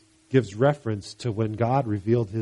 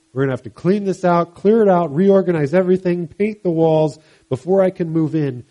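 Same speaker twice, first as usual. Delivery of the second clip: forceful demand; 5 semitones higher, 6 dB louder than usual. Acted. Jesus voiced, demanding cleanup.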